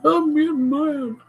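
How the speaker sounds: high, drawn-out pretend tantrum; mock angry scream. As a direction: put on a silly high wail of rage. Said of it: "Start screaming".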